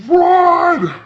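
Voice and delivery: screamed high and loud; panicked shout. Retold or imitated. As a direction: yell at everyone to flee. Friend fleeing the haunted tree.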